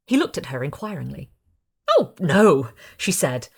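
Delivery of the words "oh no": ordinary delivery, nothing marked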